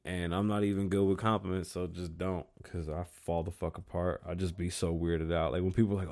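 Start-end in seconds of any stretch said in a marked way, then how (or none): none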